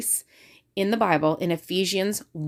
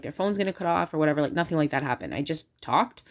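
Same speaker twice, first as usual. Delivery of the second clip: ordinary talk, nothing marked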